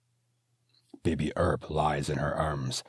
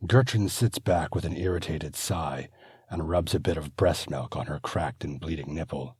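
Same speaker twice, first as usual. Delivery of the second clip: ordinary delivery, nothing marked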